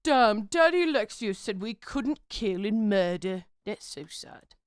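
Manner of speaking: a sulky crusader, half-hearted whine; whiny crusader voice. Pretend. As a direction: pout like a spoiled soldier.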